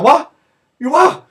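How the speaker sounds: high, loud astonished retelling; shouted disbelief. Retold or imitated. Fans looking round after the goal, incredulous shouting. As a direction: yell it as a stunned crowd.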